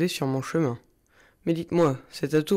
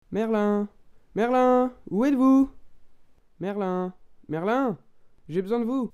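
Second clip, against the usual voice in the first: calling out; 8 semitones higher than usual. Acted. Calling out for Merlin.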